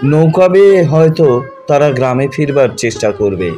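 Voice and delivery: plain reading voice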